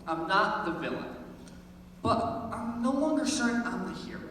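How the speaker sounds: ordinary talk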